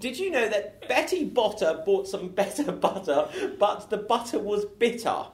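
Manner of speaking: high, loud, laughing character. Acted. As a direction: rattle it off in a high voice. Improv scene, tongue twister in character.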